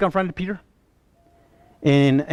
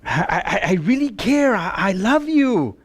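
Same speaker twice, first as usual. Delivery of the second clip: pleading; 2 semitones higher than usual. Acted. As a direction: plead earnestly, stretching the words of affection. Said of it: "he's pleading with them".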